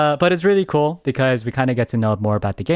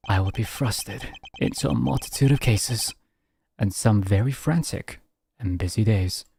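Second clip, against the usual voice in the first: slightly low, calm intro; composed hero narration; lightly voiced narrator; 4 semitones lower than usual. Narrates as Edgeworth.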